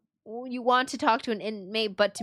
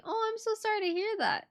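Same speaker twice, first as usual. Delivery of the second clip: ordinary talk, nothing marked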